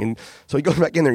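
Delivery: normal talking voice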